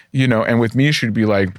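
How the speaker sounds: ordinary talk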